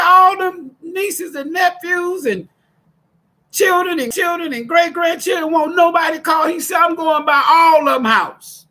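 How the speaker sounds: crying out for the family; tearful shout. Retold or imitated. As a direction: shout it high through tears. Emotional retelling of dad.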